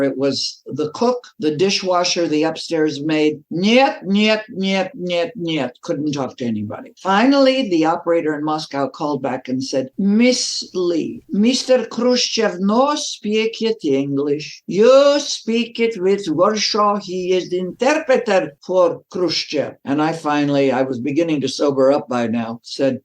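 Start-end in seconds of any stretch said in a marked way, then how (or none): 3.5-5.7 bat her away with a string of flat Russian refusals. Retold or imitated. Embassy staff refusing her calls. 10.0-19.7 put-on higher, slow broken English in a thick Russian accent; the Moscow operator struggling through English.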